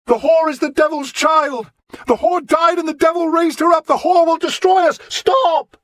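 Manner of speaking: high, loud ranting cry; a mad prophet's shout. Acted. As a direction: hurl it at the hall. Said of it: "he shouted".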